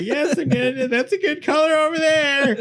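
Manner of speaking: very high falsetto painter voice; hammed-up falsetto raving over paint; carried-away painter. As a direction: cry out in mock ecstasy, way up high. Impression of painter while painting.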